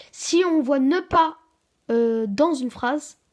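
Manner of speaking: emphatic rule. Shown as a demonstration. Child teacher lays down the grammar rule, slow and loud. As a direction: drive the rule home to the class.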